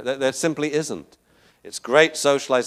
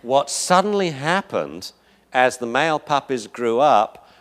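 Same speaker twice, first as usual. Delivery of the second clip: ordinary delivery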